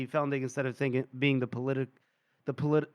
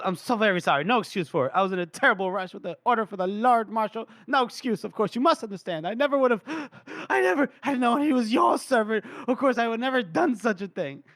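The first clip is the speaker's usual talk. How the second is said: gasping, gushing plea for mercy; profuse apology; 5 semitones higher than usual. Acted. Drunk Felnik begging Gorst's pardon, hammed-up and profuse. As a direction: tumble over yourself apologizing, pitch jumping up. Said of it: "he's drunk too, but he's, like, throwing himself".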